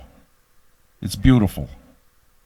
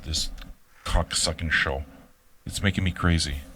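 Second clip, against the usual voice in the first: muttered annoyance; 8 semitones lower, 8 dB quieter than usual. Real, not acted. Fighting the echo.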